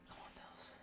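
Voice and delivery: breathy whisper, willing the team on; whispered hope. Real, not acted. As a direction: whisper it like a prayer.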